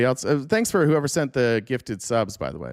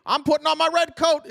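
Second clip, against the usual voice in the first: much higher, gleeful mock voice; mock eager Tory; 11 semitones higher, 4 dB louder than usual. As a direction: play the eager redcoat volunteer.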